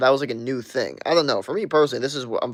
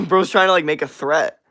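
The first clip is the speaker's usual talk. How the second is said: louder, higher, exasperated; loud outraged rant; 4 semitones higher, 7 dB louder than usual. Real, not acted. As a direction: blurt it out in disbelief. Outraged at chat message.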